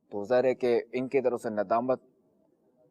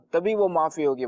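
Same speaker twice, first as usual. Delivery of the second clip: ordinary delivery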